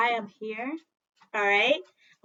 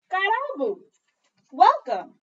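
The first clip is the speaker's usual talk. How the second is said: Karibu! called out loud; welcoming call; 7 semitones higher, 8 dB louder than usual. Acted. Mama calls the child in, loud and welcoming. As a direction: welcome them in with a big call.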